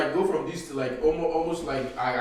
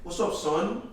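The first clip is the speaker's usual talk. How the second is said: higher put-on Brooklyn greeting; fake New York accent; 6 semitones higher, slower than usual. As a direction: greet the mirror like a New Yorker. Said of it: "an accent like this".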